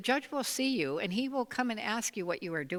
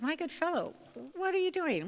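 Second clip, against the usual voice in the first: surprised; 9 semitones higher than usual. Acted. Judge surprised at man fishing road.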